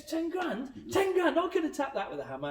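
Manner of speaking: high, loud, incredulous squawk over the price; outraged disbelief. Retold or imitated. Captain balks at the repair bill, high loud outraged disbelief. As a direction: ten grand?! for a tap with a hammer, rising into outrage.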